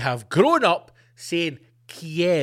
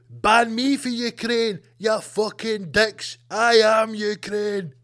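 slow, heavy tough-guy delivery; mock Seagal voice; 4 semitones higher, slower than usual. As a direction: growl it out slowly like a defiant action hero. Seagal voiced popping from tank.

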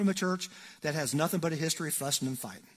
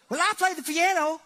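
woman's voice; 13 semitones higher, 6 dB louder than usual. Retold or imitated. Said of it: "she goes".

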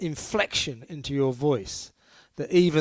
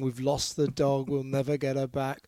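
ordinary delivery

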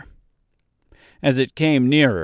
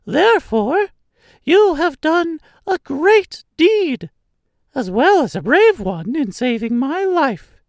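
very high, louder, measured squeak; squeaky mouse queen; 5 dB louder than usual. A narrator's reading. Mouse queen commends the Woodman for his deed, squeaky little voice. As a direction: make a tiny royal pronouncement. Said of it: "in a squeaky little voice".

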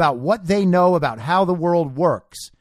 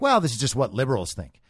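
lower, quicker, lightly mocking; mock liberal voice; 6 semitones lower, 4 dB quieter, faster than usual. Host mocking a naive liberal viewer, low and quick. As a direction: brush it off like a smug liberal. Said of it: "and you might think".

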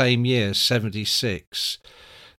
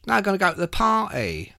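Pete impression; 7 semitones higher, faster than usual. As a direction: imitate a friend's odd way of talking, higher. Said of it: "he talked like that, Pete".

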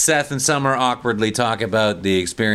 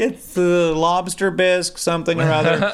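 mock prissy friend; 8 semitones higher than usual. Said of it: "one of her friends is like".

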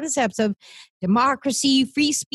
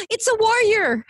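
high, breathless marvel at a warrior; awed gasp; 9 semitones higher than usual. Retold or imitated. Friend retells seeing a guest, mock awe. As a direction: gasp it out like you've spotted a hero. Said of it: "I'm like".

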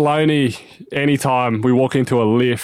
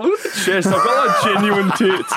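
mock show-off voice; 6 semitones higher than usual. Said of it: "He's like".

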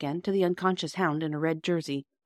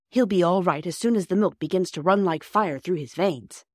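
ordinary delivery, nothing marked

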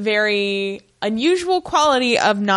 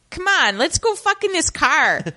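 put-on light Wisconsin accent, raised pitch; a hint of a Wisconsin accent; 8 semitones higher than usual. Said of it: "her Wisconsin accent".

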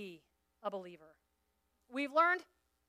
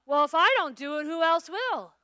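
mock martyr whine; 3 semitones higher, 8 dB louder than usual. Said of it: "mock martyr complaint".

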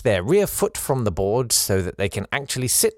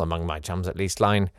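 ordinary talk, nothing marked

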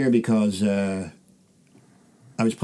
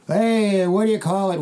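high-pitched imitation of calling out from the crowd; mock heckler call; 10 semitones higher than usual. Club heckler interrupting the comedian, high mock call. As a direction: call out like a heckler, without full volume.

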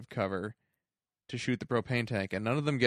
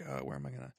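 quiet musing aside; 2 semitones higher, 7 dB quieter, faster than usual. Retold or imitated. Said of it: "looks around like".

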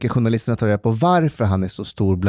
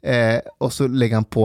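ordinary delivery

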